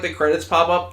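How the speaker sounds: ordinary talk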